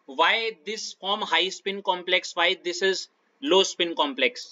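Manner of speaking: very slow, word by word, like reading out an exam question; dictating slowly. Shown as a demonstration. Tutor slowly dictating a sample exam question. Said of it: "ऐसे करके question".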